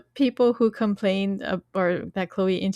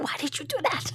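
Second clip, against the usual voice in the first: high, hushed telling-off; mock whispered scolding; 7 semitones higher, 8 dB quieter, faster than usual. Dan and Chloe scolding each other behind Lucifer's back, hushed mock scolding. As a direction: imitate scolding someone under your breath, high and soft. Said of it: "whispering at each other, they're, like, scolding each other".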